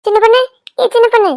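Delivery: high, loud call across the room. Acted. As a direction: shout her name to wake her. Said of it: "ஏண்டி கத்துற?".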